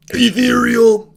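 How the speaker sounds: "ethereal" stretched out high and loud; loud, lingering play on "ethereal"; hammed-up emphasis. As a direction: draw out "ethereal" in a mock-dreamy swell. Hams up the word for dreamy state.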